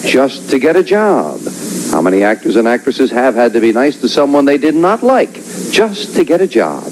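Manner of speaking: announcer reading the survey question; host voice posing the question; game show host. Acted.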